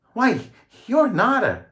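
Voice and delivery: very high, slow, loud exclaim; shocked gasp. A narrator's reading. Cop recognizing George at studio, high shocked gasp. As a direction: gasp it in disbelief. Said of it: "he gasped".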